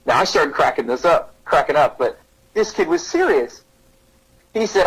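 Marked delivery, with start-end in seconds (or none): none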